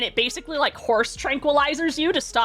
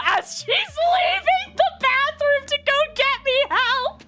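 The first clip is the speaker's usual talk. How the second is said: high, squeaky, near-tearful laughing voice; cracking up while telling it; talking through laughter; 13 semitones higher than usual. Real, not acted. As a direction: barely get the story out for laughing. Laughs helplessly telling the story.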